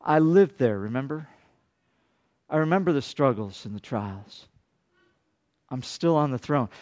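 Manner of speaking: a low, gentle, reassuring God speaking softly. Retold or imitated. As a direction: speak as God, calm and soft. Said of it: "God whispers in my ear".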